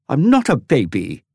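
put-on high, loud furious boy; furious protest. Dorian to Tom, high loud furious protest. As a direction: stress not, furious child. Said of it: "Dorian protested furiously".

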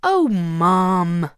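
loud whiny protest; protesting daughter. Acted. Belinda protesting to her mother, loud and whiny.

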